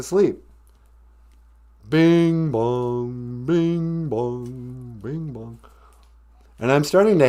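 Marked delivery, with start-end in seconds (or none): 1.9-5.6 clock chime. Retold or imitated. Imitating the grandfather clock chime.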